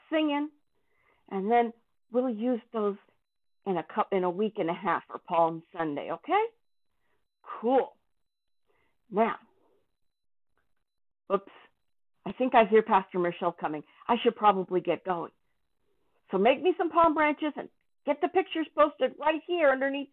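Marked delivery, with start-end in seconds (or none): none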